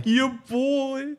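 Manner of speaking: teasing echo. Pretend. Co-host repeating the tease about a favourite player, mock incredulous. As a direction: throw it back high in mock disbelief.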